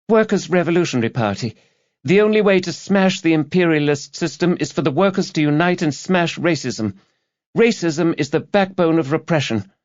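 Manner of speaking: put-on high young woman's voice, preaching her politics; earnest young activist. A narrator's reading. Student activist lecturing the detective, high zealous voice.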